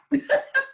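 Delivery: a short high squeal of laughter. Real, not acted. Flustered under the lights, laughing high. Laughing about feeling hot.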